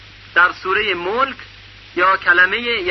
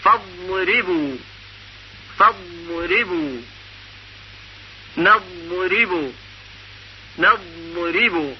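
فضربوا and نضربوا drawn out slowly with the ض stretched; slow tajwid recitation; 4 dB quieter, slower than usual. Shown as a demonstration. Teacher recites examples slowly for students. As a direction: recite each word slowly, savouring the ض.

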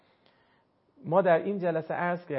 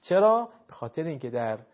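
ordinary talk, nothing marked

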